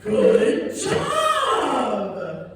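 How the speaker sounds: high, exaggerated cheer for a small child; gushing therapist praise. Therapist praising a grown patient like a toddler, high and gushing. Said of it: "looked me dead in my eyes and went".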